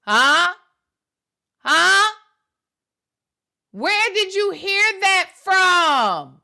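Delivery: slow, drawn-out mocking question; mocking mimicry. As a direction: parrot him slowly, mock-questioning. Mimics the dad in the played clip.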